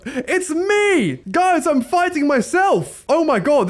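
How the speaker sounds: high-pitched, stretched-out cry of amazement; excited shout. Real, not acted. Player discovers enemy is his lookalike.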